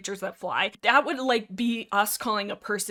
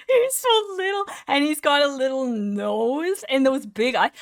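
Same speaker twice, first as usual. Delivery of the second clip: gushing over cuteness; 4 semitones higher, 5 dB louder than usual. Real, not acted. Rating the cute dragon Turtle, high cooing gush. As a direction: coo over a tiny cute creature.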